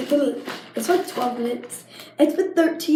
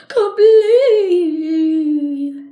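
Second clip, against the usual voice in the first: drawn-out mock cry, loud and higher; mock wail; 5 semitones higher, 11 dB louder, much slower than usual. Complaining to viewers the squishy isn't round, mock wailing. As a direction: ham up the despair on "Completely".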